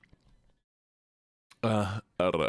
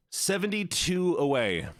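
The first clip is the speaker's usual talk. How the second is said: higher, stretched "seventy-two away" with a dramatic pause; drawn-out announcement; 6 semitones higher than usual. Real, not acted. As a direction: reveal "seventy-two away" like big news. Announcing follower count after pause.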